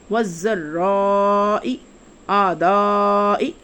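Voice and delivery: الباسائی والذرائی آدائی said slowly, each سا را دا vowel held four times long; slow recitation, each long vowel pulled out; fourfold madd. Shown as a demonstration. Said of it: "چار گنا لمبا کر کے".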